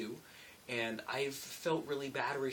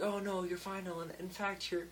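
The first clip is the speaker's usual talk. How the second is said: higher, bright coworker voice; lightly pitched-up quote of her compliment; imitating Amber; 7 semitones higher than usual. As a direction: raise the voice to mimic her praise. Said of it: "she was like".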